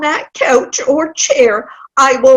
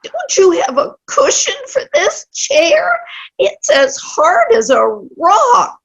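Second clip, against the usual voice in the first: high, whining gripe; whiny complaint; 11 semitones higher than usual. Acted. Old woman whining to her hostess about the chair. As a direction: whine about the hard chair.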